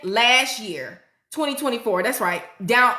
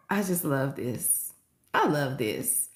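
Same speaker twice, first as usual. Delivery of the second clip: touched; 10 semitones lower, 7 dB quieter, slower than usual. Real, not acted. Touched by a viewer's comment.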